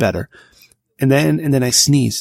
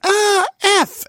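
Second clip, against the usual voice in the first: very high-pitched mock squeal; high, exaggerated child's yelp; imitating scared daughter. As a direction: squeal it like a startled little girl. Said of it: "then she was like".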